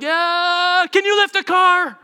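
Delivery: high, loud, eager shout; excited kids' yell. Retold or imitated. Friends asking the uncle eagerly, excited yell. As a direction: blurt it out high in wild excitement.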